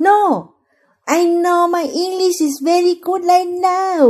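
put-on drawn-out, high drunken Thai-accented English; เมาสำเนียงไทย. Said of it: "เถียงเมาอยู่บอกว่า". (เถียงแบบคนเมา สำเนียงไทยจ๋า เสียงสูงลากยาว)

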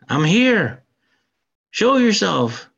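hero's challenge. Retold or imitated. Retelling a hero calling out the demon in a dark hall, a mock challenge. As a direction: call out to the hidden demon. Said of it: "So then he says".

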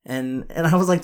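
ordinary talk

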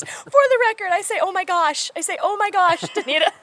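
high-pitched mock-indignant mom; protesting parent. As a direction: set the record straight, high and amused. Acting a parent's indignant correction.

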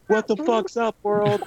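squeaky high bird greeting the world. Retold or imitated. Birds at dawn greeting the world, high squeaky voice. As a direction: chirp it like a cheerful morning bird. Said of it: "they're like".

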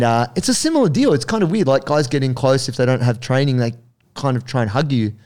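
normal talking voice

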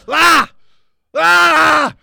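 hammed-up ranting barks of Ah; screaming like a ranting dictator; loud mock yells of Ah; mock Hitler shouting. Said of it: "I saw videos of him just going".

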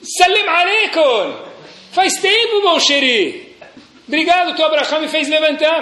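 high, hearty acted greeting; hammed-up joyful resurrected ancestor; great-grandfather returned. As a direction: hail a long-lost relative in a high voice. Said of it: "Teu bisavô".

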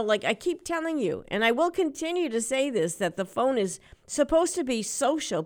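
usual speaking voice

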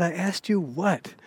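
old wife mock-yelling her question again; imitating old lady shouting. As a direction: spell it out loud to the deaf husband. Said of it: "they're talking real loud to one another".

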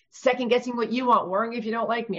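ordinary talk

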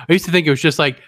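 ordinary talk, nothing marked

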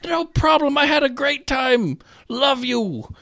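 high, tender mock farewell; gracious loser. Loser congratulating Susan, high mock gushing. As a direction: gush warmly in a high voice. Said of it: "And he's like".

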